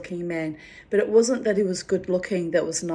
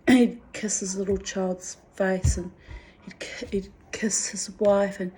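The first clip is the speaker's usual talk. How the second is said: ordinary talk, nothing marked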